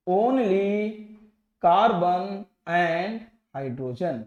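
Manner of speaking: word by word, with gaps between; slow dictation. Shown as a demonstration. Teacher writing out a definition for students, slow dictation. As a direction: spell out the key terms at writing speed.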